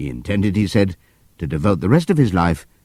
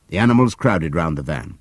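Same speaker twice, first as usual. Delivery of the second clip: usual speaking voice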